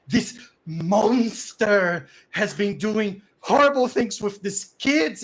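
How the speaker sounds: slow, loud, high denunciation; acted furious, drawn-out accusation; lots of anger. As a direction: denounce him word by word. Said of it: "looking at Sipato with lots of anger".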